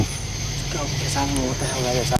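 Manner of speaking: fast, low, frightened whisper; scared whisper. Real, not acted. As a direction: whisper urgently, spooked. Scared whisper fleeing noise.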